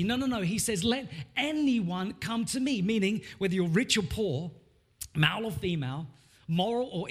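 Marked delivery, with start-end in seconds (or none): 0.8-2.8 "anyone" lifted high and slowed; stress on anyone. Shown as a demonstration. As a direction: linger on "anyone". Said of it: "the emphasis is on the word".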